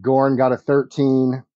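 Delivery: ordinary delivery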